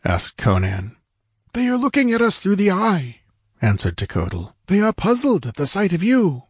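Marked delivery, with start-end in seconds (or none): 1.5-3.2 high-pitched voice for an anxious warrior; anxious character voice. A narrator's reading. Narrator voices Techotlal answering. 4.7-6.4 high-pitched voice for an anxious warrior; anxious character voice. A narrator's reading. Narrator voices Techotlal answering.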